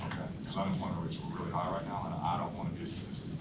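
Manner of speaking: just a guy trying to keep his job; low-key, unsalesy. Shown as a demonstration. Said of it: "I don't sound like I'm trying to sell".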